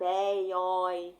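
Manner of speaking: "Ei, oi" sustentado, com é e ó abertos; ditongo aberto. Shown as a demonstration. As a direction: prolongue "Ei, oi" como éi, ói. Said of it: "Não é aberto?". ("Ei, oi" de vogais abertas e esticadas)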